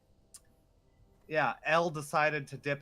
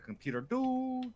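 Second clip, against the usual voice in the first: computer beep; 12 semitones higher than usual. Retold or imitated. Imitating a computer sound.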